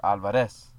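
Alvarez stressed at the end, al-va-REZ; stress on last syllable. Shown as a demonstration. Contrasting ways to say his surname, al-va-REZ. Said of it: "It has a difference".

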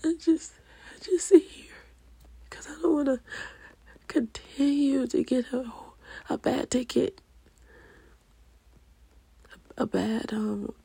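high, cracking voice breaking into tearful whispers; trying not to cry while confessing her loneliness; fighting back tears. Real, not acted. Alone on camera, struggling to speak through real tears. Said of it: "I'm trying my best not to cry".

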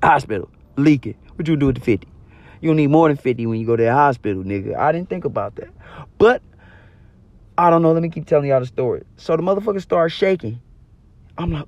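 usual speaking voice

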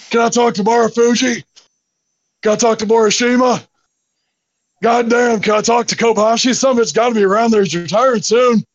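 hammed-up Stone Cold phone promo; Steve Austin impression. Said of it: "I did my Steve Austin impression".

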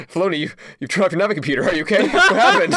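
high, fast, rising panic; a frantic studio boss, climbing to a laughing squeak; worried executive voice. Retold or imitated. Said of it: "And then Disney says".

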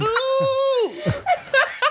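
high crying laugh; helpless laughter; laughing fit. Real, not acted. Laughs at long grunt.

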